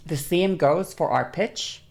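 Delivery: normal talking voice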